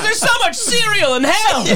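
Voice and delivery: high laughing mock line; giggling mock exclamation; laughing quote. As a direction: blurt it through laughter. Mocking reaction to clip.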